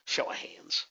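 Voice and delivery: breathy whisper, no voice at all. Shown as a demonstration. Host whispering the request to the webinar audience, hushed.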